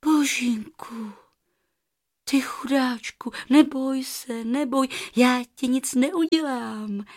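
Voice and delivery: calm the frightened goose gently; soothing. Acted. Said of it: "řekla Rozárka konejživě". (konejšivě, jemně a tiše)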